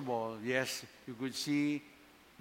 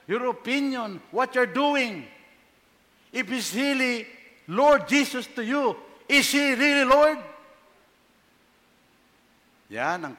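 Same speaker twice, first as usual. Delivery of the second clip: higher and louder, pressing the question; rising fervour, driving it home to the people; impassioned preaching; 6 semitones higher, 6 dB louder than usual. Acted. Pastor challenging the congregation, fervent and loud.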